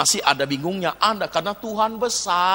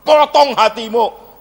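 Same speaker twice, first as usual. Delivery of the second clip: loud, high, drawn-out order; forceful command; 6 semitones higher, 8 dB louder, slower than usual. Real, not acted. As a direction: shout it as a stern preacher. Orders the person to cut it off. (perintah lantang dan tegas)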